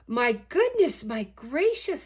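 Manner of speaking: high, loud astonished cry; amazed shouting. Acted. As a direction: gasp it out to the crowd. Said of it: "they shouted".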